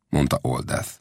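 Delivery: plain reading voice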